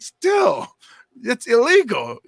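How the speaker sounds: high, loud scolding shout; shouting at the shoplifting nephew; retold shout. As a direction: yell the rule at the kid.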